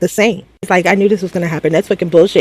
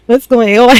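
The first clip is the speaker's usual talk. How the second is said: higher, louder mock bewilderment; lost Carl; 5 semitones higher, 5 dB louder than usual. Carl stuck between the arguing cast, bewildered. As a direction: play it confused and caught in the middle.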